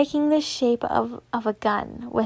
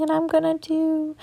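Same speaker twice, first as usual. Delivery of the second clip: ordinary delivery, nothing marked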